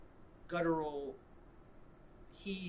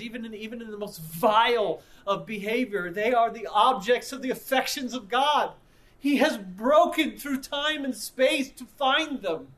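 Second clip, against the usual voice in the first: rising louder toward a preached peak; building sermon; 4 semitones higher, 9 dB louder than usual. Acted. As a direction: climb to the height of the sermon.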